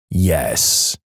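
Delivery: low, certain yes; grave confirmation. A narrator's reading. Sleeper answering doubtful wife, grave.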